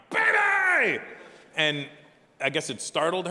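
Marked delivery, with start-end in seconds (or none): 0.1-1.0 loud high call bursting in; shouting baby. Retold or imitated. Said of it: "I burst in and I'm like".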